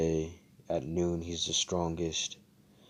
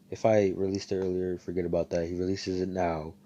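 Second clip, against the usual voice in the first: ordinary delivery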